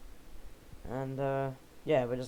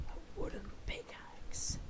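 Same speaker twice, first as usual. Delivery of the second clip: high, slow, breathy whispered voice; silly whisper; 7 dB quieter, slower than usual. Pretend. Gamer to viewers over a new pickaxe, high slow whisper. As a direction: whisper it like a secret treasure reveal. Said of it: "I didn't intend to do it in that voice".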